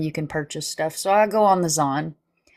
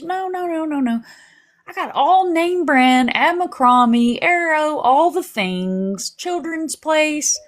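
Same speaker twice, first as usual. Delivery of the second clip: much higher, slow and emphatic on the brand names; imitating the mom, high and slow on each brand; proud refusal; 8 semitones higher, 3 dB louder, slower than usual. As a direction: insist everything is name brand. Daughter-in-law refusing thrift clothes.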